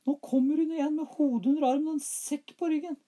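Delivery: put-on very high, loud frightened boy. A narrator's reading. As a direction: squeal it like a scared child. Smørbukk warns mother.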